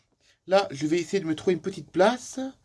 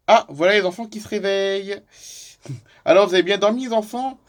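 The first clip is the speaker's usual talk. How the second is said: put-on higher, louder call to children; cheerful greeting; 5 semitones higher, 4 dB louder than usual. Father to his children waking up, cheerful greeting. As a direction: greet them with lifted voice.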